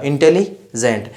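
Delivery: Intelligent slowly in two chunks, Intelli then gent; syllable split. Shown as a demonstration. Teacher showing each part as one syllable, Intelli-gent apart. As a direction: leave a gap before the gent of intelligent. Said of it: "ये वाला एक syllable हो गया".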